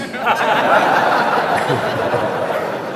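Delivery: breathy laugh. Real, not acted. Laugh at camat joke.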